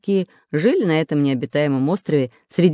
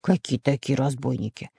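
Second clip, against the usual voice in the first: low, softer professor's question; wary; 4 semitones lower, 5 dB quieter than usual. Acted. Professor questions the warning, wary. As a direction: ask guardedly, pitched down. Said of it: "насторожился профессор Пыхтелкин".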